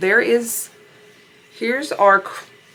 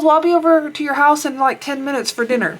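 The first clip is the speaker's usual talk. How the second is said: higher, louder sister-in-law; sister-in-law's voice; 7 semitones higher, 5 dB louder than usual. Retold or imitated. Sister-in-law announcing she's coming to dinner, higher and louder. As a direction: voice her, pitched up and loud. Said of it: "She goes".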